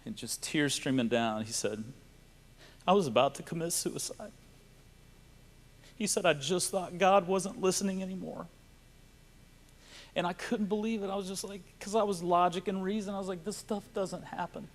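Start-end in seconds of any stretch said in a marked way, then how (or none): 2.8-8.5 high, choked-up retelling of a confession; tearful. Real, not acted. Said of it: "These are tears of joy". 10.2-14.7 high and soft, overcome; real choked-up, hushed high voice; tearful. Real, not acted. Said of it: "These are tears of joy".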